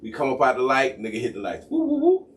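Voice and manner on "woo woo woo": high whooping siren noise; mouthed siren blips; imitated police siren. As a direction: whoop like a squad car siren.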